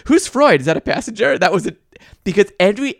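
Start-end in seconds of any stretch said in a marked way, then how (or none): 0.1-1.4 lightly voiced clueless questioner; high, puzzled opening question, easing down; Ismay. Podcaster quoting Ismay's ad-libbed line, mock puzzlement. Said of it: "he, he goes".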